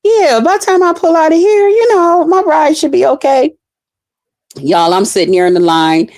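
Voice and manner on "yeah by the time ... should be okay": high, light self-assured thinking voice; inner thought. Retold or imitated. Driver telling herself the ride will be fine, high and breezy. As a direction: sure it will all work out. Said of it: "So I'm like".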